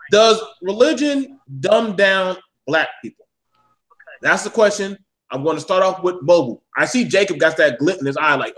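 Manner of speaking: ordinary talk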